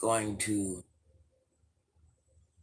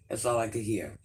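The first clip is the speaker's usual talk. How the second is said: ordinary delivery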